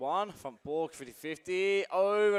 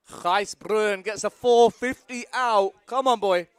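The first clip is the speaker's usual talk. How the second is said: excited shout; 4 semitones higher, 10 dB louder than usual. Real, not acted. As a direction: call the trick with excitement. Cheering last rider.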